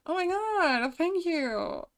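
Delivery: high, touched thanks; real high-pitched gratitude; delighted surprise. Real, not acted. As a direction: react with pleased astonishment. Reacting to praise from chat.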